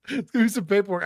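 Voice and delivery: fast, loud, through laughter; laughing. Real, not acted. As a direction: laugh it out quickly. Laughing at fart joke.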